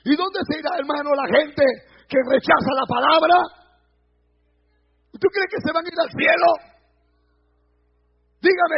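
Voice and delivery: loud, rising fire-and-brimstone climax; preaching at full force. Acted. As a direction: shout it out to the congregation. (predicador gritando con fuerza)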